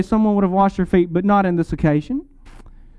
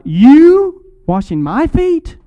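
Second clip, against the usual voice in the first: slow, higher, louder disbelieving question; "You washing my feet?" drawn out in astonishment; incredulous Peter; 7 semitones higher, 5 dB louder, much slower than usual. Retold or imitated. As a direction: ask it as if it can't be true. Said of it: "Peter is emphasizing what Jesus is doing".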